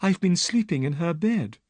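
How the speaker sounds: put-on frightened young woman telling her story; Miss Stoner.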